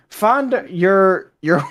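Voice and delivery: put-on slow, halting Irish accent. Struggling with the soldier's written words, Irish accent. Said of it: "I'll do an Irish accent".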